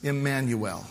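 usual speaking voice

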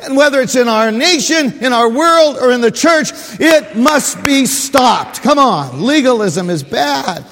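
loud, high, pounding sermon; fiery preaching. Acted. Pastor rousing the congregation, full-force preaching. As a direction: drive the point home hard.

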